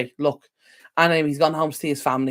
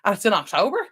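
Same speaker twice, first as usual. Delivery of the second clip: retold surprise; 9 semitones higher than usual. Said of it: "I was like".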